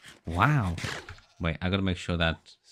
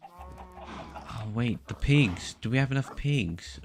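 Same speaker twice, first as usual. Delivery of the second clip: sudden realization; 6 semitones higher, slower than usual. Real, not acted. Suddenly notices the pigs.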